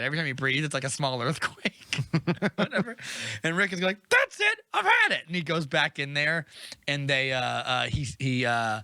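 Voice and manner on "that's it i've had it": high, loud mock shout; fed-up outburst. Man storming off at the noisy dragon, exasperated. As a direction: explode as if patience just ran out.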